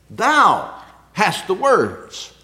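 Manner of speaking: "Thou" लंबा और ज़ोर से, धीमा; stress on thou. Shown as a demonstration. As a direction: lean hard on Thou, slow. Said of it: "There was an emphasis on thou".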